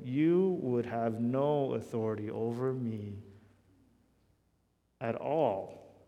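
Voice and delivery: a quiet, understated hint of emphasis; emphatic. Preacher voicing Jesus answering Pilate, mildly emphatic. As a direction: lightly lean on each word.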